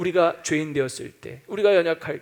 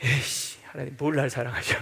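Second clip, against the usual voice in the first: grumbling curse; 5 semitones lower, 4 dB quieter than usual. Retold or imitated. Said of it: "실패하면".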